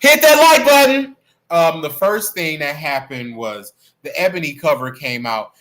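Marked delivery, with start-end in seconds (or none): 0.0-1.2 very high, very loud shout; sudden yell. Real, not acted. Streamer interrupting the story to demand likes, yelling. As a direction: blast it out mid-sentence.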